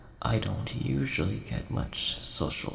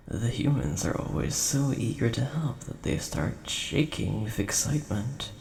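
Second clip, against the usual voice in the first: ordinary talk, nothing marked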